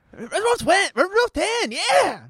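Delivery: very high squeaky cat voice; kitten voice. Pretend. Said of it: "That's pretty good".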